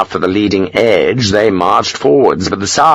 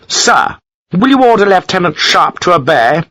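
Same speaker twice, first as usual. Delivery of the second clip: indignant Gibbons; 9 semitones higher than usual. A narrator's reading. Gibbons appealing to Hogan.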